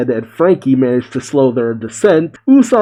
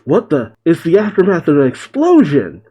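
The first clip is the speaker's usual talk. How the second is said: ordinary delivery, nothing marked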